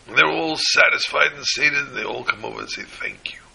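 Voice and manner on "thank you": hushed thanks. Retold or imitated. Guests thank the rich man.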